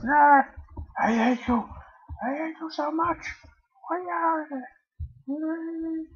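squealing in frustration; high-pitched frustrated wail. Real, not acted. Raging at the game.

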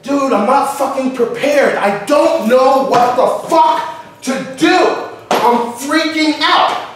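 slow, emphatic, acted desperation; mock freaking out. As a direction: imitate a friend going crazy, pitch rising. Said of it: "he's going fucking crazy".

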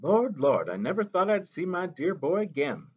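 put-on a gentle old mother reminding the boy; Mo Mo Bay. A narrator's reading.

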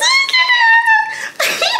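very high excited squeal-laugh; shrill delighted laugh. Real, not acted. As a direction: squeal with glee.

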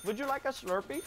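comic Indian tech-support voice; mock Indian accent. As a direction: insist in a put-on Indian accent. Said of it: "switch your voice like that".

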